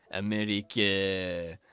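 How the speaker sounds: drawn-out French-accented America. Shown as a demonstration. Player jokingly renaming colonial America for viewers, French accent. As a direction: say it slowly in a put-on French accent. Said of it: "that was my French accent".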